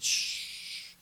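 soft unvoiced shush. The Lord telling him to stay silent, soft shush. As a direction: hush them gently. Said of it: "the Lord said".

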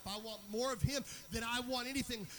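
high, very loud preaching, building to a shout; impassioned preacher at full cry, stretching key words; full-force preaching. Real, not acted. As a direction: drive it to a shouted pulpit climax.

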